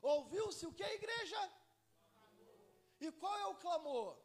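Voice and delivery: loud, slow, drawn-out sermon cry; preaching at full force. Acted. Pastor thunders the midnight cry to the church, slow and loud. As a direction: stretch every word to shake the church awake.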